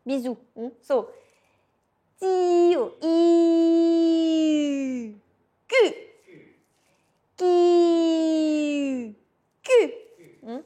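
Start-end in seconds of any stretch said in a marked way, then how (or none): 3.0-5.1 E held, lips rounding into a kiss; French U, lips pushed forward; French U sound. Shown as a demonstration. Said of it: "you change your mouth like a kiss". 7.4-9.9 Q held long, lips rounded; French Q with rounded lips; French Q sound. Shown as a demonstration. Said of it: "You hold the vowel".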